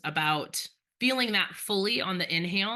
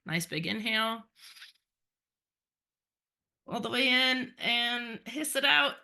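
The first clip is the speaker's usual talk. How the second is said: guided breathing. Acted. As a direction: lead the class gently through breaths.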